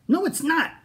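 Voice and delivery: high, loud indignant cry. Acted. Minosaur cries back at Apatosaur, high and loud. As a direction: fire back at once. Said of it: "cried Minosaur".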